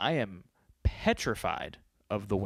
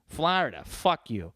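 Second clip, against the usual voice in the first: higher, punched-out Florida with an open "ah"; defiant; 5 semitones higher, 4 dB louder than usual. Acted. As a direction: throw it back defiantly, hitting the first word. Defiantly repeats the mocked accent word.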